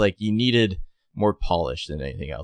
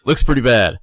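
louder wooden movie line; bad acting; 5 semitones higher, 8 dB louder than usual. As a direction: deliver it stiff and overdone. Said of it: "the line reading is so, so awful".

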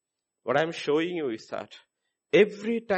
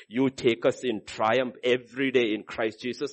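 normal talking voice

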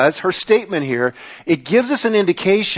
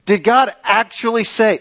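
higher-pitched scoffing challenge; mocking disbelief; 5 semitones higher than usual. Shown as a demonstration. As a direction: query the command as if it were absurd. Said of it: "or mocking the command".

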